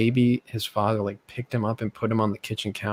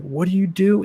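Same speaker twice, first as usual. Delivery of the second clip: higher-pitched protest, imitating the kid; mock alarm; 8 semitones higher, 4 dB louder than usual. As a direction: blurt it like a startled victim. Acting out kid being beaten.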